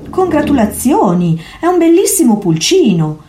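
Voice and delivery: high, bright praise; cat admiring the baby gull, delighted; delighted congratulations. A narrator's reading. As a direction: gush over the new chick.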